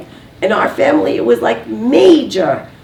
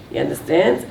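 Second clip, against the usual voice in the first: ordinary talk, nothing marked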